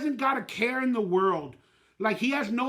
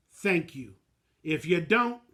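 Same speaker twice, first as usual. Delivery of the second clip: usual speaking voice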